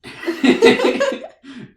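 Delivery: high, loud burst of laughter; shrieking laugh. Real, not acted. Teller cracking up at her own story, a loud high shriek. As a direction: crack up hard.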